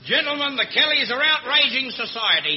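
pompous high voice. Acted. Pompous governor repeats address.

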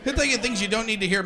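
ordinary talk

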